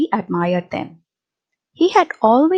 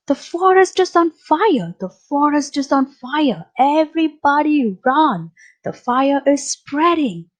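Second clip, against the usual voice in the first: higher-pitched storybook cry of alarm; mild alarmed shout; 6 semitones higher than usual. A narrator's reading. Beaver warning everybody of the forest fire, mild high shout. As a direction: raise the alarm in a high voice, little extra volume. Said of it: "Beaver shouted, shouting".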